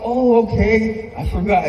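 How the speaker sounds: high, slow hammed-up oh; mock realization. Dad playfully pretending he forgot his son's name, hammed-up. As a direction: drag out the fake realization.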